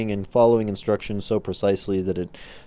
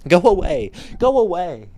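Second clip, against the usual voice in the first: loud, higher go away; loud mock shout at the phone; 7 semitones higher, 7 dB louder than usual. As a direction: shove the noise away with your voice. Yelling at vibrating phone.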